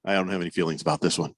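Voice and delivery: low, quick deadpan brush-off; Vance Joseph brushing it off. Retold or imitated. The coach waves off a reporter's question in a low, quick deadpan. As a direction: mutter it fast and low like a coach dodging the question. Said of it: "He's like".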